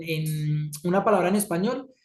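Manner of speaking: ordinary talk, nothing marked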